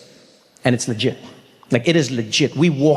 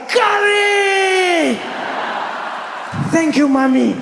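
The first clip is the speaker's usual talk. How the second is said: put-on boy thrilled by curry; ecstatic kid shout; much slower than usual. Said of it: "I was like".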